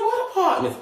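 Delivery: high, startled question; shocked. Retold or imitated. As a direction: jump up in alarm. Shocked self-quote.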